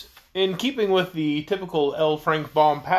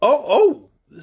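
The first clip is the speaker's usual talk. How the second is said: higher, louder exclamation; raised-pitch little "oh"; surprised girl; 7 semitones higher, 6 dB louder than usual. A narrator's reading. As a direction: react as a startled young girl. Girl reacts to the creature's announcement.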